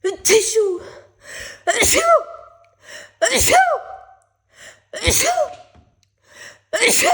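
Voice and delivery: high, loud pre-sneeze gasps; about to sneeze. Real, not acted. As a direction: gasp it out before a sneeze. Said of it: "I'm super sneezy today".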